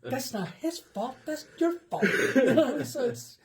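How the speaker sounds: laughing loudly while talking. Real, not acted. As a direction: talk through big laughs. Laughing through the joke.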